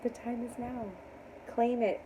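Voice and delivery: soft echo of a rallying call; hushed affirmation. Real, not acted. Reader repeating her call to viewers, hushed. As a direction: say it quietly, letting it settle. Repeats it softly after pause.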